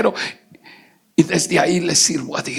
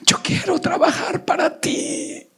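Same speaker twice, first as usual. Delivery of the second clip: tearful yes; 13 semitones higher, slower than usual. Retold or imitated. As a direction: say yes to the Lord while crying. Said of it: "llorando le dije". (entre lágrimas, agudo y suave)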